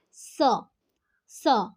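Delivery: स alone as a short "suh"; isolated letter sound. Shown as a demonstration. Teacher answering which sound C makes, isolated sound. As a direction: sound out स by itself, pause, repeat. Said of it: "sound हम क्या निकालेंगे".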